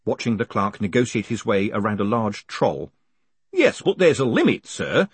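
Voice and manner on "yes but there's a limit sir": mildly annoyed hangman, pitched up. A narrator's reading. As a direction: object to a breach of etiquette. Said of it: "annoyed at this breach of etiquette".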